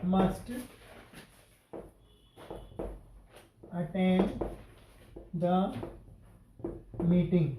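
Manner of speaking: each word set apart with long pauses; slow dictation. Shown as a demonstration. Teacher dictating an example sentence to students, slow word by word. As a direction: dictate it to the class one word at a time. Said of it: "example dictated to class".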